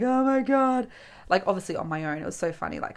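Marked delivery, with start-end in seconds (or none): 0.0-0.9 higher, whiny imitated sob; hammed-up weepy lament; mock crying. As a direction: wail it lightly as if in tears. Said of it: "I'd sit there crying".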